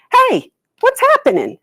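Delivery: high, loud curious call; turtle yells. A narrator's reading. Turtle yelling up to birds in trees, high and loud. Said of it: "and he yells".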